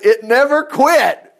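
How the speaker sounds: high, loud and slow; emphatic stretch. Real, not acted. Preacher stresses the endless supply, slow and loud. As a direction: lean hard on it never quit.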